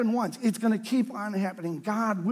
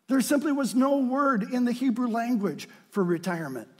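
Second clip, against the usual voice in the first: usual speaking voice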